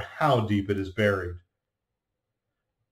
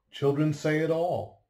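ordinary delivery, nothing marked

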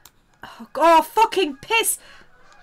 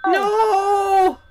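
anguished shout; 11 semitones higher, 9 dB louder than usual. Real, not acted. Drawing game panic, crying out.

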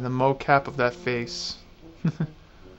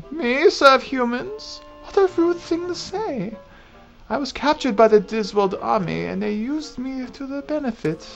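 put-on indignant old crone, pitched up; old lady voice; 8 semitones higher than usual. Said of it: "I get to do an old lady voice".

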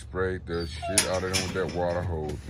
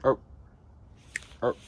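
calling the dog; 4 semitones higher than usual. Real, not acted. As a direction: tell the dog up.

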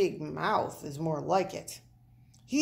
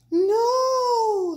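very high, loud alarmed yell; crowd yell; 9 dB louder than usual. Acted. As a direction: shout to stop him. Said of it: "the crowd yelled".

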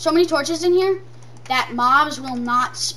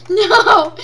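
laughing no; 5 semitones higher, 9 dB louder than usual. Real, not acted. Laughing panic.

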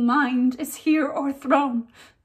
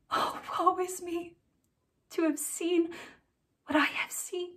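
tragic heroine sorrow; 5 semitones higher, 8 dB quieter than usual. Acted. Ophelia's lament, hushed.

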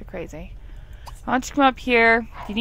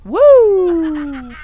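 high, loud "Woo!" for the sim; mock cheer; 8 semitones higher, 10 dB louder than usual. Voicing the sim's delight at his lit pumpkin, cheering. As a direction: cheer like a thrilled kid.

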